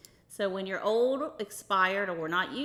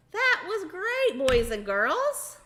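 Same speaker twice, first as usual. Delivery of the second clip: enthusiastic praise; 8 semitones higher, 5 dB louder, slower than usual. Acted. Praising the children.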